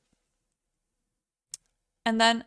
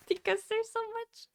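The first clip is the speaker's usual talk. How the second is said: high squeak of overwhelm; squeaky overwhelmed voice; 12 semitones higher, 5 dB quieter than usual. Real, not acted. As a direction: pitch it way up, overwhelmed. Overwhelmed by pages of notes.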